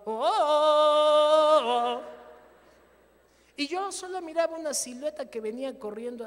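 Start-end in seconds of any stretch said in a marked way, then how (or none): 0.0-2.0 long loud drawn-out jungle yell; loud slow Tarzan call. Retold or imitated. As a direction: belt it out like Tarzan swinging in. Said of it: "cuando Tarzán gritaba".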